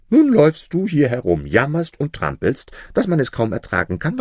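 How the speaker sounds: loud, high complaint, quickening; scolding jinni. A narrator's reading. Roused jinni berates Maruf for stamping about, scolding. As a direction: scold the noisy intruder, starting loud and high.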